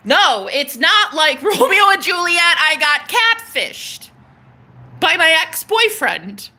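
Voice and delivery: high, exclaimed protest with a laugh inside; indignant rebuttal. Real, not acted.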